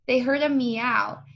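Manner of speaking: usual speaking voice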